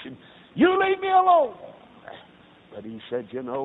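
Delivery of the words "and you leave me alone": much higher and louder, a furious yell; irritated father shouting at his son; angry shout. Retold or imitated. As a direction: snap at the child to leave you alone. Father angrily brushes off son.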